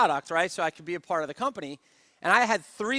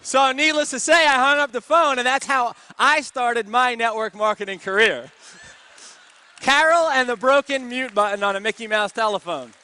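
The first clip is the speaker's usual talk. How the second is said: louder and higher, fired up; rousing climax; 5 semitones higher, 8 dB louder than usual. Real, not acted. Wrapping up his story for the seminar crowd, loud and excited. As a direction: build it up for the crowd.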